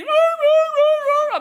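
loud, very high repeated howls; imitated dog crying. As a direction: imitate a desperate dog yelping at full volume. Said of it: "He's crying like he's dying".

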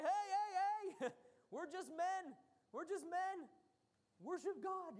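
high, loud calls with a laugh breaking in; alarmed protest. Retold or imitated. Apostles stopping a crowd from worshipping them, in laughing, urgent protest.

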